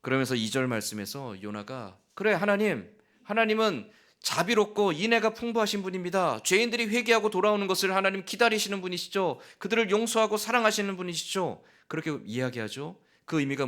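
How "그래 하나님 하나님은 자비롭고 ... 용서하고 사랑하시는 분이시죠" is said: high, sneering mock praise; sarcastic. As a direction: drip sarcasm as you praise. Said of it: "비꼬는 거예요".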